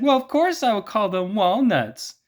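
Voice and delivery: high falsetto woman in a joke; woman's voice. Retold or imitated. Woman in the joke answering the man, high falsetto. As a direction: squeak it up as the woman. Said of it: "And she said".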